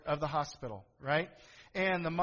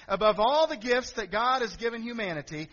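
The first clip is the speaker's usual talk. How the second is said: ordinary delivery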